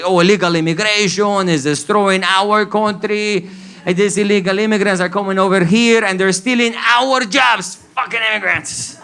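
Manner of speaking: a Cuban cabbie ranting, rising into a yell; ranting taxi driver. Retold or imitated. Taxi driver ranting to his passenger about immigrants, building to a shout. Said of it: "he was like".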